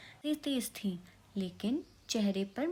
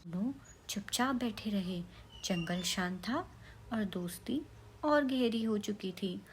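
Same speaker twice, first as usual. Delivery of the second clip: ordinary delivery, nothing marked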